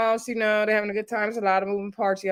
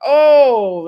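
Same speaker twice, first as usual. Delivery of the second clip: shocked oh; 4 semitones higher, 9 dB louder than usual. Real, not acted. Reacting to a harsh line.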